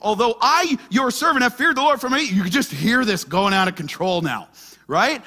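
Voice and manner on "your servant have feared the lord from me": ordinary delivery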